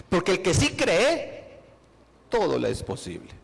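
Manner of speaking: the promise proclaimed at full volume, slowing on the first half; preaching loud. Acted. Preacher proclaiming to the congregation that all is possible, loud. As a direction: declare it to the whole church.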